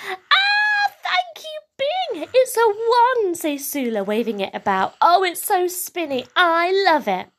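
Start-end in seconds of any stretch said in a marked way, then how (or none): 0.1-3.4 very high squeal easing into thanks; squeaky gift-opening delight; delighted child. A narrator's reading. Sula delighted opening her present. 5.0-7.3 put-on high, gleeful little-girl voice; delighted child. A narrator's reading. Sula thrilled with her wand.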